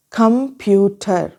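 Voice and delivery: कंप्यूटर broken into कम, प्यू, टर; कंप्यूटर sounded out slowly, each vowel distinct; syllable-by-syllable. Shown as a demonstration. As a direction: say कंप्यूटर slowly in three separate beats. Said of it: "three vowel sound जो है कंप्यूटर में".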